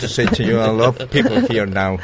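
slow Chilean broken English speech; awkward award speech in bad English. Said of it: "hablar en inglés inventado". (discurso en inglés inventado)

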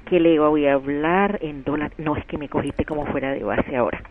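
ordinary talk, nothing marked

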